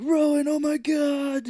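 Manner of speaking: high, loud, slow cry of alarm; hammed-up panic. Cage seeing Rowan on the pyre, loud high panic. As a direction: ham up Cage's horrified cry. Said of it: "and he's like".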